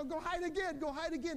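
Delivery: put-on high, excited child voice; eager begging kids, imitated. Kids begging dad to repeat.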